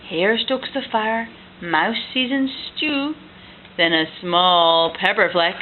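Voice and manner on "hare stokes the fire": ordinary delivery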